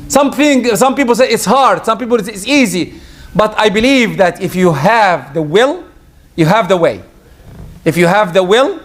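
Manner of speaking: normal talking voice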